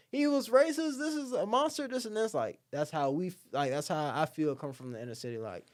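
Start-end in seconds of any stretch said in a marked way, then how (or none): 0.1-2.3 much higher mocking sing-song voice; mocking Democrats. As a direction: parody the people dissing him in a high whine.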